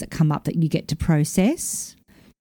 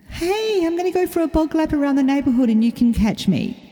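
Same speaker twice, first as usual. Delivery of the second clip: high cheeky dog voice, much higher; dog's voice; 9 semitones higher than usual. Retold or imitated. Owner voicing dog daring her to chase, high playful voice.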